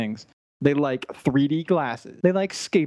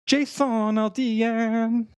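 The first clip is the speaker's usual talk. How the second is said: announcer voice; 8 semitones higher, much slower than usual. Pretend. Hammy announcement of American name.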